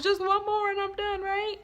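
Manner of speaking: lightly whiny plea; mock bargaining kid. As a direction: wheedle like a kid dodging vegetables. Child bargaining over vegetables.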